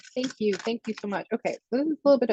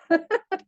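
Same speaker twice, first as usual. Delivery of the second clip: giggle; 7 semitones higher than usual. Real, not acted. Laughs after birthday wish.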